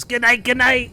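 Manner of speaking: high, loud goodnight call; bright double goodnight; cheery goodnight. Retold or imitated. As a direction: call it out happily across the room. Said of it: "He goes".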